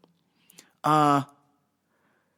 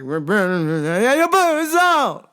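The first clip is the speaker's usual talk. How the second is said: mumble into yell; 4 dB louder than usual. Retold or imitated. Customer asking for salt, mumbling then suddenly yelling. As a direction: imitate garbled mumbling that bursts into a yell. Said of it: "but then would yell".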